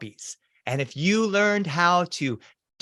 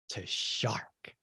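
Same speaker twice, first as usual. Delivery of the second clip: spooky whisper; 6 semitones lower, 14 dB quieter than usual. Acted. Announcing the scariest swim level.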